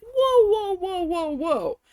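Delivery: high, loud string settling downward; alarmed interruption. Retold or imitated. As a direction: cut in high, then bring it down. Acted interrupting the manager.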